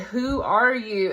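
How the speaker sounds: loud and slow, a card demanding answers; slow booming question. Retold or imitated. Tarot reader voicing the card's challenge, slow and loud. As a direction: drag out each word, loud and probing. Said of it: "it said".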